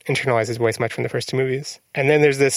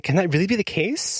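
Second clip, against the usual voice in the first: higher-pitched retold wonder, rising at the end; mock disbelief; 6 semitones higher, 3 dB quieter than usual. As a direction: play up the incredulous question.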